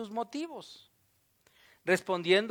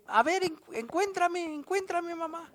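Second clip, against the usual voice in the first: put-on much higher, playful little child hiding; child's voice; 9 semitones higher than usual. A child hiding, teasing mom to come find him. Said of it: "tiene niños así que se esconden".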